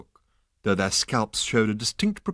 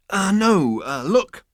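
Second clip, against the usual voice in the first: flustered; 11 semitones higher, 6 dB louder, slower than usual. Acted. Zaphod flustered.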